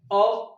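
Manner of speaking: "औ" said as the open vowel between आ and ओ; teacher models "औ" as a single open vowel; pronunciation demo. Shown as a demonstration. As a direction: hold "औ" between आ and ओ, like English "aw". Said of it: "आ और ओ के बीच से बोला जाता है".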